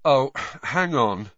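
slow, dawning realization; said slowly. A narrator's reading. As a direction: draw it out as the penny drops. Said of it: "said Harry slowly".

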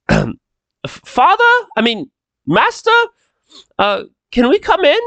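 high, halting servant voice; Micah. Acted. Servant knocks on the master's door, high hesitant voice. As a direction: call through the door, pitched high, correcting yourself. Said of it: "he goes".

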